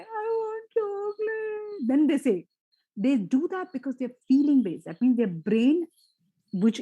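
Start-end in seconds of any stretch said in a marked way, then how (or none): 0.0-1.9 high, slow mock wail of a little kid; imitating a small crying child, high and drawn out; whiny crying child. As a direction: whimper like a toddler who wants chocolate.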